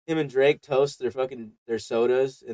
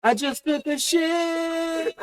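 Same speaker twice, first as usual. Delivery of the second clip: high, slow, soft mock voice; mock TV star voice; 5 dB quieter, much slower than usual. Friends imitating a TV star, high and slow. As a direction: say it like a dopey TV star. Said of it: "He would sound like he would say that".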